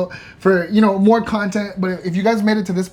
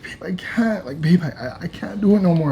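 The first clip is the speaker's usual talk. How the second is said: breathless whispered plea, all fight gone; imitating her broken, near-silent whisper; defeated whisper; 4 dB quieter than usual. Wife in labour tells him she can't go on, defeated whisper. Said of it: "she sounded defeated".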